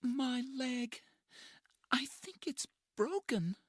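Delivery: put-on injured young woman. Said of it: "She begins to cry".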